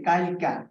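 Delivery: কাইলকা with the i moved before l; dialect kailka. Shown as a demonstration. Teacher showing Barishal dialect কাইলকা. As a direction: say কাইলকা like a villager. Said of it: "কালকে আমরা কি বলি".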